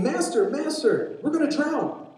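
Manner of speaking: high-pitched acted cry for help; frantic disciple in a storm; desperate plea. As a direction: plead in panic, drawing out the name. Disciples wake Jesus in the storm.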